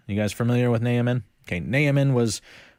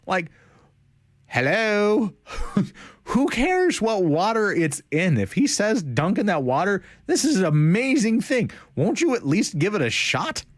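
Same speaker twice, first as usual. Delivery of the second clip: higher, exasperated voice; incredulous plea; 7 semitones higher than usual. Pretend. Preacher voicing the servants' baffled urging to Naaman, exasperated. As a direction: come on, just try it!. Pleads for Naaman to just try.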